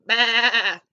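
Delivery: loud, wobbling sheep baa; sheep bleat. A narrator's reading. Sheep greets Blue, loud bleat. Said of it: "Sheep said".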